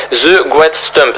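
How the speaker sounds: English map name in a thick French accent; bad French-accented English. Real, not acted. Gamer reads out the map's English name, bad French accent. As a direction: say the English slowly with French sounds. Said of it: "mon accent est, est merdique".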